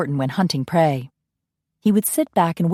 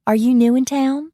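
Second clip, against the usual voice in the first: high, louder friendly belle question; Southern belle; 8 semitones higher, 3 dB louder than usual. Acted. Coralie asking the stranger, high bright belle voice.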